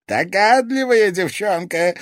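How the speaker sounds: high, loud exclamation; villain's exclamation. A narrator's reading. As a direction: exclaim it loudly at a clever girl. Villain exclaims at the girl's guess.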